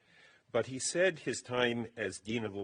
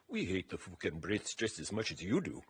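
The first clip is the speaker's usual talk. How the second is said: put-on young Scottish guard's voice, broad Scottish accent; 2 semitones lower, 4 dB quieter than usual. Young Scots guard grumbling about the Brits to a prisoner, in Scottish accent. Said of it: "adopting a perfect Scottish accent".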